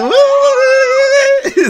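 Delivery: very high, loud, drawn-out mock squeal; mimicked squeal. Streamer mimicking woman on video, high mock squeal. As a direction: imitate her squeal, high and long. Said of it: "She said".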